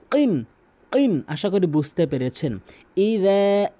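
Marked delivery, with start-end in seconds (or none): 0.1-1.2 কিন said high, with the ক from the back of the tongue; isolated qaf. Shown as a demonstration. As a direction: drill the qaf alone. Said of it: "জিহ্বার গোড়া উপরে তালু থেকে". (কফের উচ্চারণ)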